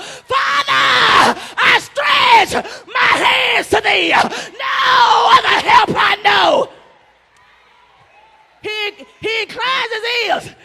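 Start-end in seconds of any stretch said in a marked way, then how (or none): 0.0-6.7 slow, loud pleading cry to the Father; crying out in prayer. Acted. Preacher crying out to God as Father, slow and loud. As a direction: plead upward, drawing every word out.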